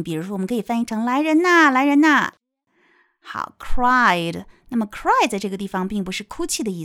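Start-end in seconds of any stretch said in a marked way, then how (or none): 1.1-2.3 raised, higher acted call; calling for help. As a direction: summon people to come and help. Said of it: "是寻求帮助的声音".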